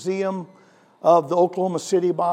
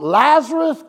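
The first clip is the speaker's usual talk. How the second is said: a raised preacher's call, name lifted high; calling Lazarus out of the tomb; mock yell; 5 semitones higher, 4 dB louder, slower than usual. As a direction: call the dead man out by name. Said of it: "he yells out".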